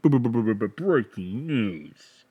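slow stuttering mock news jingle; stuttered news sounder. Host fakes the breaking news sounder himself, slow stutter. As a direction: imitate a glitchy breaking-news sting, slowed down. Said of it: "Somebody hit the breaking news sounder right now".

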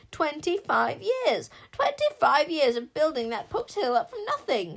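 loud, slow mournful lament; mourning. A narrator's reading. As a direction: mildly wail over lost years. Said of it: "she mourned".

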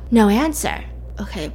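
ordinary delivery

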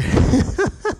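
high-pitched giggle; high laugh. Real, not acted. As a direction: let out a squeaky laugh. Laughs after stoner joke.

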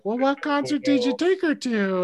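prying mother in falsetto; mock mom voice. Mom asking about the girl, high mock mother voice. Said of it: "my mom's like".

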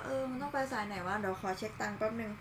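soft self-talk under the breath; muttering to himself. Retold or imitated. Commuter mumbling about which line to take. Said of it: "สมมุติ อ่ะ กำลังจะขึ้น บีทีเอส บอกว่า".

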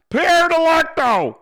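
loud, high, drawn-out impersonated yell; high-pitched mock yell, stretched out; Wheels yelling. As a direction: holler it out slowly like a cartoon cop. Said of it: "I told you he sounds like Joe".

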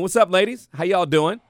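ordinary delivery

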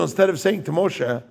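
ordinary talk, nothing marked

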